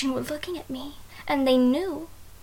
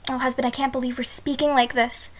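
usual speaking voice

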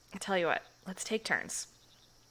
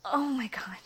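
higher, louder, whispered excitement; aroused breathy exclamations; breathy pleasure; 4 semitones higher, 5 dB louder than usual. Acted. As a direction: gasp it out in delight.

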